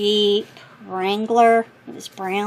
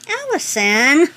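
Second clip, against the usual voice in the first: drawn-out, louder, rising call of the name; excited call; 6 semitones higher, 6 dB louder than usual. Real, not acted. Reading the sender's name, excited and louder.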